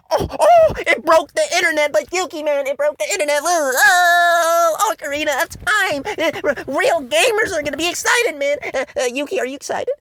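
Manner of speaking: high, far, excitable fanboy voice; mocking hyped fans. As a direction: gush like a clueless fan. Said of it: "people already going like".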